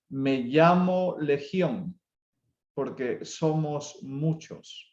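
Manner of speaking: very slow, heavy, each word apart; slow ominous reply. Acted. Preacher voicing the demon Legion to the congregation, slow and heavy. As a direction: let the demon's name sink in slowly. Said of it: "escucha bien".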